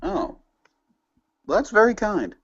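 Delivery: high, coy, flattered. Acted. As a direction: act coyly flattered. Flattered reply, pitch jumps up.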